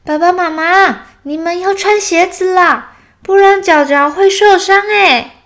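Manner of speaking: put-on high, loud worried child voice; anxious cub calling out a warning; nervous little bear. A narrator's reading. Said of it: "小熊很紧张的赶快跟他们说".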